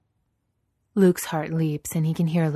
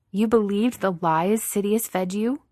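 higher, mildly disgusted question; 5 semitones higher, 4 dB louder than usual. A narrator's reading. Ahsoka confronting Vader over Sidious's lies, disgusted. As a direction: challenge it with a touch of disgust. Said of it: "Ahsoka says with disgust".

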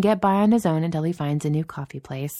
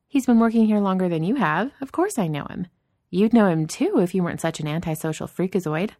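higher-pitched, needling boss voice; Danny, teasing boss; 4 semitones higher, 3 dB louder than usual. Acted. Boss ribbing an antisocial colleague, playful scorn. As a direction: rib him playfully, pitched up.

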